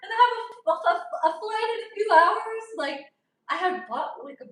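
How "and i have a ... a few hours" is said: loud, high, indignant protest; retold angry complaint.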